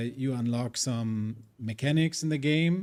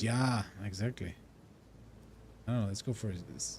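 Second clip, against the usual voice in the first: ordinary talk, nothing marked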